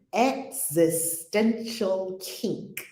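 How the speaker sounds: each word stretched out slowly; slow deliberate title. Shown as a demonstration. Host slowly spelling the book title out for her chat. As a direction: draw out Existential Kink syllable by syllable. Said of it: "Here's the book".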